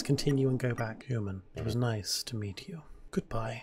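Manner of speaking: a hint of a deep kind king; Asgore voice. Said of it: "He actually says".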